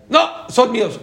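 selfish refusal. Acted. Greedy person refuses to share.

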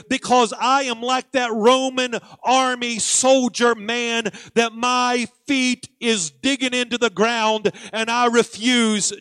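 preaching that builds to full force; rousing pulpit exhortation with stretched key words; impassioned preaching. Real, not acted.

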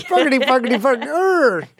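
high, loud mock-Irish drill; Irish accent warm-up. Shown as a demonstration. Co-host demonstrating the Irish trick, high hammed-up accent drill. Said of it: "like, an Irish accent".

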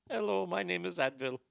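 put-on soft-spoken comic Indian accent; put-on Indian accent on a phone greeting. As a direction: imitate an Indian call-center agent, softly. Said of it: "Please do this in an Indian accent".

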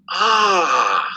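loud, high, drawn-out "aah"; "ah" of revelation. Retold or imitated. Lieutenant finding Mexico in his dictionary, imitated "ah" of revelation. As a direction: imitate someone suddenly getting it. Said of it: "you know, like, some revelation".

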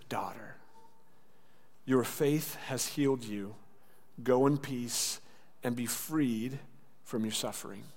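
slow, soft, gentle blessing; tender reassurance. A narrator's reading. Jesus reassuring the healed woman, tender. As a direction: release her gently, phrase by phrase.

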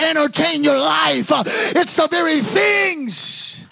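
full-force preaching. Acted.

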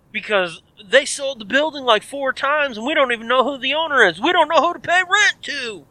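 high mock rant, climbing toward the end; agitated neighbour. Neighbour explaining why he squats, high agitated mock rant.

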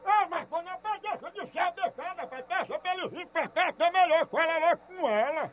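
half-laughing call across the room, away from the mic; calling off-phone. Real, not acted. Caller urges Marcos from afar, half laughing.

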